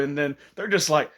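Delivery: ordinary delivery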